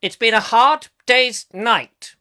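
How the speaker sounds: mock-Shakespearean recital, slow and loud; high, drawn-out theatrical declamation; Peter Sellers. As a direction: declaim pop lyrics like a grand stage actor. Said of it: "the master of Shakespeare ... Peter Sellers".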